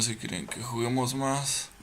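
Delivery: normal talking voice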